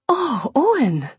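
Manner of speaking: high, slow swoon; intoxicated rapture. A narrator's reading. Imogen overcome by the scent, high swooning rapture. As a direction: draw it out, overcome with delight. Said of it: "She swayed as if intoxicated".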